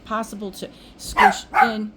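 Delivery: normal talking voice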